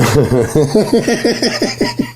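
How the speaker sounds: rising high giggle; laughter. Real, not acted. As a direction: burst into climbing giggles. Laughs at own skit.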